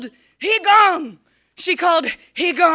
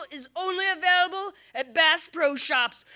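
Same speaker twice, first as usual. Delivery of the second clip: ordinary delivery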